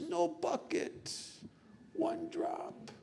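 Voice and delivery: high, soft, slow menace; hushed high villain voice; Doctor Chan. Acted. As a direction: correct him softly and very slowly. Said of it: "this little Doctor Chan comes in".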